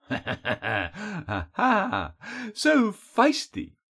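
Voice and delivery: mocking, swooping, drawn-out taunt; chuckle, then tease with high lilting ends; amused captor toying with his prey; throaty chuckling villain. A narrator's reading. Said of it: "A throaty chuckle followed".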